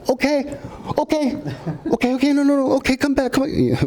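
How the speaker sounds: high, squeaky mock begging; panicked producers. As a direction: ham up the producers pleading to come back. Producers chasing the car.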